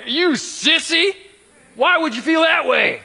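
high, loud, slow mocking taunt; mocking wife. Imagined wife scorns her husband's confession, high mocking taunt. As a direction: sneer it like a scornful spouse. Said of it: "Do you think she responded like".

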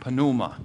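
pneuma with a slight P before the N; Greek pronunciation. Shown as a demonstration. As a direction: demonstrate pneuma slowly as puh-NOO-mah. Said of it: "you pronounce the P just a little bit".

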